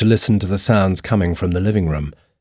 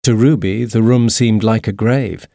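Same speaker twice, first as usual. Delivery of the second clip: ordinary delivery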